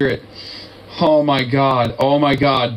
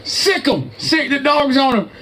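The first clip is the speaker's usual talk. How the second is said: much higher, laughing mock shout; laughing fake-urgent call; mock alarm; 10 semitones higher than usual. Real, not acted. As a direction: hype it up like sounding a silly alarm. Mocking cops, laughing.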